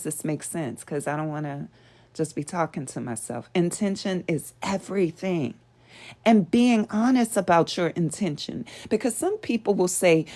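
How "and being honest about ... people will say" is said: usual speaking voice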